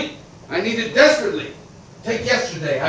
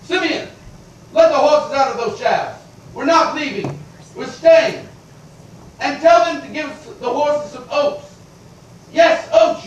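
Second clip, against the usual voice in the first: calling out; 7 semitones higher than usual. Acted. Calls out to his coachman.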